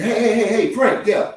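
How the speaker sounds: high, loud buddy-buddy lion; chatty lion. Pretend. Lion casually quizzing Frank the zebra, high and loud. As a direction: greet your prey like an old pal.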